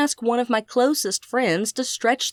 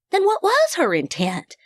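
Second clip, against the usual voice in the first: curious question; 8 semitones higher than usual. A narrator's reading. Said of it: "Coralie asked".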